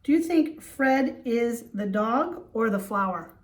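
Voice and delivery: ordinary talk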